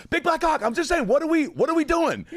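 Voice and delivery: very high, loud insistent outburst; mock indignant protest. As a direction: protest while being thrown out. Acted protest while being kicked out.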